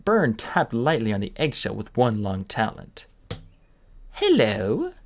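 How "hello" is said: high, loud sing-song hello; calling out. A narrator's reading. Queen calling into the egg, high and loud. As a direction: call sweetly into the egg. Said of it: "she called".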